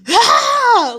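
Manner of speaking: loud high-pitched scream of rage; enraged scream. Retold or imitated. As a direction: let out a furious shriek. Rage at broken routine.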